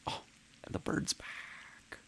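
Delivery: soft whispered wonder at the bird; hushed whisper. Real, not acted. Streamer watching the bird return, whispering softly. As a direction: hush so the bird stays.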